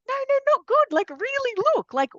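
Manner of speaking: very high, pleading "no, no, not good"; insistent excitement. Retold or imitated. Wife insisting to husband on the shoots' wonder, very high. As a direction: insist he really look, full of wonder. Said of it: "And I'm like".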